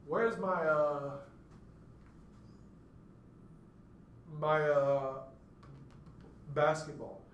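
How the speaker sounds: long pauses round the repeated "my, uh"; hesitant repetition example. Shown as a demonstration. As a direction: repeat "my, uh" after a long wait, then "basketball" cleanly. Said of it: "let me give you two examples".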